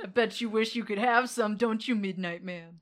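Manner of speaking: loud mocking sing-song tease; taunting. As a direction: taunt him like a bully. Taunting demon.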